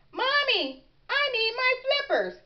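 put-on high-pitched child's plea; pleading little frog. A narrator's reading. Froggy whines to mother while sinking.

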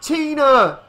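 high, loud cry. A narrator's reading. Father on the dock to his daughter, loud cry. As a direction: cry out the name to someone far off. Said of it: "he cried".

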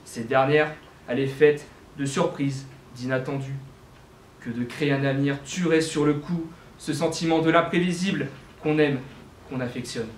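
ordinary delivery

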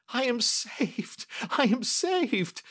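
high, drawn-out cry of relief; shriek of joy. A narrator's reading. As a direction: cry out high and slow, overjoyed. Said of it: "With a shriek of joy".

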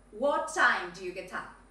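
"What time" stressed, then drop down to "get up"; falling intonation. Shown as a demonstration. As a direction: let the question fall away to a low "get up". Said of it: "fall in intonation".

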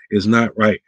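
ordinary talk

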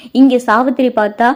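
ordinary delivery, nothing marked

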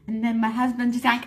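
ordinary delivery

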